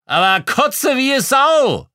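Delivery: put-on loud, high drunken bawl. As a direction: holler it with mocking glee. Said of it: "Aus der Ferne gröhlte es".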